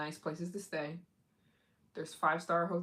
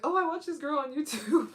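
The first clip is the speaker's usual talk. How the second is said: giggling through it, pitched up; laughing reply; 11 semitones higher, 7 dB louder than usual. Retold or imitated. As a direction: laugh while confessing the reason. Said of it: "You'd be like".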